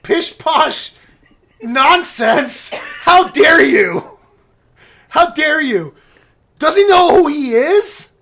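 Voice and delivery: high, loud hammed-up outburst; mock indignation. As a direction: play it scandalized, punching each phrase loudly. Retold indignant outburst at uncle.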